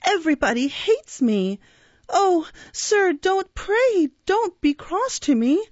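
put-on high, pleading child's cry; tearful plea. A narrator's reading. Orphan begging the beadle not to be cross, acted tearful plea. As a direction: implore like a distressed little boy, voice way up. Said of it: "cried the child".

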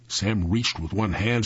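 ordinary delivery

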